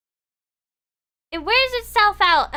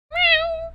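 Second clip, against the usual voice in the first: high, louder meow; cat meow; 12 semitones higher, 3 dB louder than usual. Acted. Narrator voicing the stray cat given a mouse, high meow. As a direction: mew like a hungry cat.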